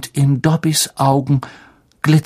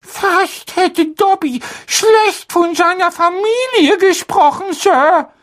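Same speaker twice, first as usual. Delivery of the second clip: very high, timid elf voice; a fretful little house-elf; squeaky house-elf voice; 3 dB louder than usual. Acted. Dobby the house-elf speaks.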